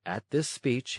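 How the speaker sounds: plain reading voice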